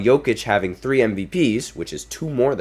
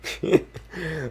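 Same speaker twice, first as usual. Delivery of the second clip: high laugh; 8 semitones higher, 5 dB quieter than usual. Real, not acted. Laughing at Shaq's go-to line.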